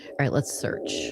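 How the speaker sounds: a breathy whisper to herself. Real, not acted. Streamer whispering to herself while hunting for a file. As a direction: whisper it under your breath while searching. Muttering while searching her computer.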